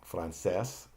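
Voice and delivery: Francés stressed on the final CÉS. Shown as a demonstration. Teacher showing learners the accent, CÉS stressed in Francés. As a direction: land on the last syllable of Francés. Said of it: "the accent is on the last syllable".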